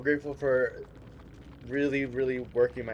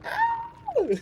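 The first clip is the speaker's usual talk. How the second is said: excited yelp. Real, not acted. Streamer squealing with joy after the win, a high yelp. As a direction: let out a thrilled squeal of a yelp.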